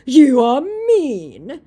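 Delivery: high, loud, drawn-out whine; mock crying scammer. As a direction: wail the complaint mockingly, very slow. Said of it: "Crying emoji".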